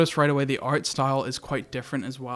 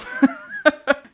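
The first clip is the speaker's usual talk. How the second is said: burst of laughter; 12 semitones higher, 6 dB louder than usual. Real, not acted. Delighted by the meteo spell.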